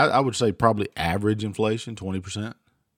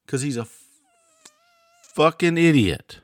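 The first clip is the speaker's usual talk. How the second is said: angry outburst; 8 semitones higher, 5 dB louder than usual. Real, not acted. Host ranting about the president, angry outburst. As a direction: spit out the insult.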